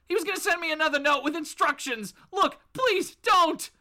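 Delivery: high, hurried begging; panicked pleading. Acted. Bob answering Batman, begging in a high frightened voice. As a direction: rush the answer, then drag out the plea. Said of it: "And Bob goes".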